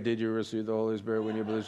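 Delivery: low, quick, toneless run-through; imitating a casual read. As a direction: rattle it off low and fast. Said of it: "some of you just read it like".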